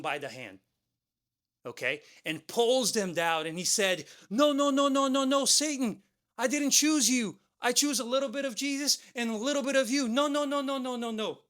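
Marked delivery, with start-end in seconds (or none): 4.3-11.4 high, urgent acted protest; panicked pleading. Man dragged down by Satan protests, acted panic and pleading. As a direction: plead in a rush of high-pitched no's.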